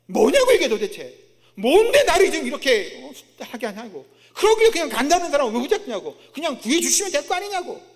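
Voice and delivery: loud, high acted griping; indignant outburst, imitating an angry Jonah; confrontational protest. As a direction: demand answers in exasperation. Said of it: "막 따지는 겁니다".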